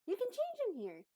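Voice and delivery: high-pitched character voice; helpful Abby. Acted. Abby shows Croak where to change, in a high voice. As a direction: offer it brightly, pitched well above normal.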